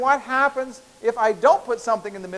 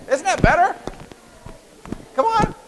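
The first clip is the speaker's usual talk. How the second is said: high cheerful urging; 12 semitones higher, 4 dB louder than usual. Real, not acted. Teacher urging students to stretch, in a high excited voice. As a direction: coax them, pitched well up and bright. Professor urging class to exercise.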